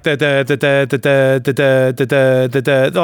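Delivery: monotone run of stammered syllables; mock mumbling. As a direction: imitate fools stuck babbling syllables. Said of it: "a bunch of mumbling fools".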